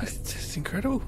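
awed whisper, breathy hush. Real, not acted. Marvelling at the film in a whisper. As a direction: hush it out in wonder. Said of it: "whispered awe".

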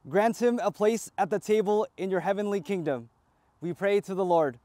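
ordinary talk, nothing marked

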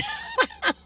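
high squeaky laugh; high-pitched giggle. Real, not acted. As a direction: giggle at yourself. Laughs at own joke.

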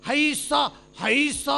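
drawn-out heave-ho; pole-lifting work call. Acted. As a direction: imitate workers calling out to lift a pole. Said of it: "ಹೈಸ ಅಂದ್ ರೂಢಿ ಇವ್ರಿಗೆ".

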